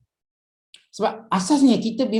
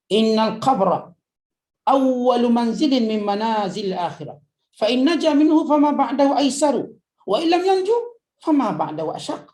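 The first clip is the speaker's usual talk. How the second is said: fervent recitation; 4 semitones higher, 4 dB louder than usual. Acted. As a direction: chant the Arabic with mounting force. Recites Arabic hadith fervently.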